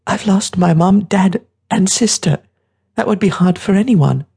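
put-on high boy voice, close to tears; young boy, upset. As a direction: plead your loss like a wounded child.

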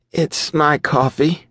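high, slow and soothing; smoothing over. A narrator's reading. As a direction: soothe the tension gently. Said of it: "she smoothed over".

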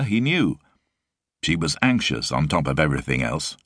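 ordinary delivery, nothing marked

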